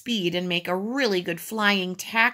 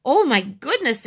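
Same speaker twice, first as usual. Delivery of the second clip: raised, startled exclamation; higher, louder gasp of surprise; exclaiming; 6 semitones higher, 6 dB louder than usual. A narrator's reading. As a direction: react with loud dismay. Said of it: "exclaimed Missus Grumby".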